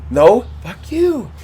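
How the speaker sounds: a stranger swears, he mocks back slowly; mock swearing. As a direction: drag it out slow, teasing the stranger.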